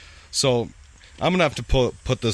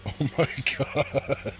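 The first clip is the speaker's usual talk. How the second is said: breathy, stunned whisper; shocked whisper; 5 semitones higher, 5 dB quieter, much slower than usual. Real, not acted. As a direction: gasp it out in disbelief. Stunned reaction to John Cena picture.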